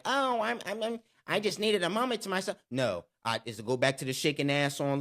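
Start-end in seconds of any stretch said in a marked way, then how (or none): 0.0-2.6 higher, halting mock excuse; lightly voiced girlfriend stammering. Mocking how his ex excused her lost weekend, higher. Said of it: "coming back into my life like".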